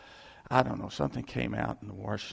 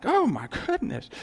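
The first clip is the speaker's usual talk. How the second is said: mock surprise; 10 semitones higher, 4 dB louder than usual. Mock exclamation.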